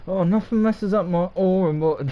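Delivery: high, sassy put-on accent; full of sass. Shown as a demonstration. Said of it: "why the accent?".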